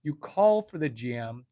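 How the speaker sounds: ordinary delivery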